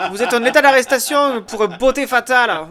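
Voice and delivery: high loud mock cop; police officer. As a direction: bark it like a comic cop. Mock police arrest skit.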